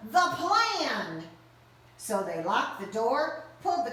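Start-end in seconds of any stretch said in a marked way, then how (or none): 0.1-1.3 urgent cry. Acted. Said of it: "cried Owl".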